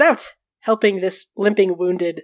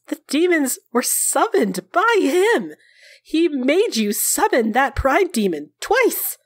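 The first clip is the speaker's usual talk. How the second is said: higher, slow, leaning on key words; insistent rebuttal; 7 semitones higher, slower than usual. A narrator's reading. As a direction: drive the point home word by word. Tama argues back emphatically.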